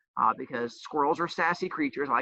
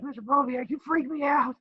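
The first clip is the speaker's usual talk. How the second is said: high, lightly voiced nervous kid; imitating a child; 10 semitones higher than usual. Teacher imitating a student's message saying he's scary, high and mock-nervous. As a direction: squeak it like a spooked student. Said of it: "in messages like".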